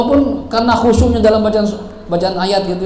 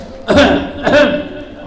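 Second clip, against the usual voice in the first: acted throat-clearing cough; 4 semitones higher than usual. Shown as a demonstration. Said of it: "sholatnya dehem".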